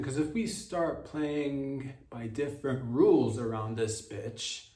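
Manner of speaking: ordinary delivery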